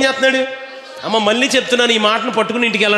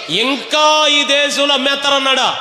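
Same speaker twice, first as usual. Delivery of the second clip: drawn-out, high-pitched rhetorical question; 8 semitones higher, slower than usual. Real, not acted. Preacher quizzing the congregation on what God said, drawn-out questioning. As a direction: stretch it out high, prompting a denial.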